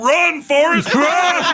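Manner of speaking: high, drawn-out holler; full-voiced mock cheer-on; urgent shout. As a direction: yell it across a crowded train. I heard one guy go.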